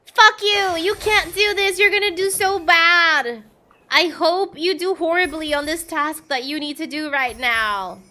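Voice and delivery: loud high jeering yell; mock angry heckling. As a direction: heckle like a hostile crowd. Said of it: "people started going like".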